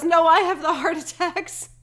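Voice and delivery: loud, high retort; mock protest. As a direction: belt it out as a comic correction. Acts out indignant retort to dad.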